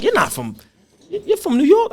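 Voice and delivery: high, incredulous "you're from New York?"; imitating surprised New Yorkers. New Yorkers doubting he's from there, high incredulous question.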